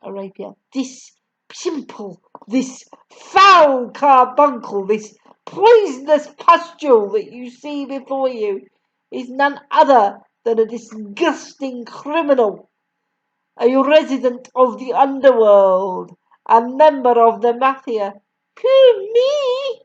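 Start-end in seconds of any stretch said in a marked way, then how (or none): 0.7-18.1 loud, disgusted denunciation; scornful tirade. A narrator's reading. Headmistress denounces the boy. 18.6-19.8 very high, startled squeak; puzzled surprise. A narrator's reading. Boy puzzled at accusation.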